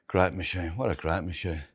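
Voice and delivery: normal talking voice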